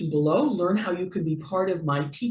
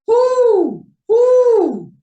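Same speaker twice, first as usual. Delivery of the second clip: very high, loud falling whoo; owl hoot; 10 dB louder than usual. Acted. Owl hooting at night.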